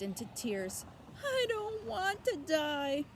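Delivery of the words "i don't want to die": high, slow, loud tearful moan; weeping piglet moaning his fear. Acted. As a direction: draw it out in misery. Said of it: "he moaned".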